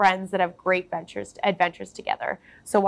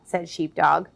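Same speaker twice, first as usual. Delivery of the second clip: ordinary delivery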